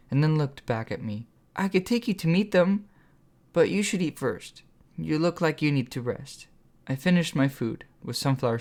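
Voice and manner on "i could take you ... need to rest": put-on eager young woman voice. A narrator's reading. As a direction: coax him gently in a higher voice. Sunflower character voice.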